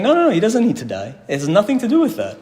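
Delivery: voicing the rabbis brushing the idea aside, high and insistent. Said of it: "So they're saying".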